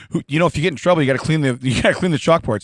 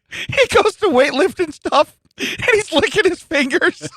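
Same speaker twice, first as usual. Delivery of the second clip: laughing; 13 semitones higher, slower than usual. Real, not acted. Laughing while describing him.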